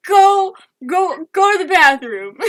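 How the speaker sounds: loud, urgent re-enacted orders; mock yell, ordering little brother off; retold yelling. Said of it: "I'm yelling at him".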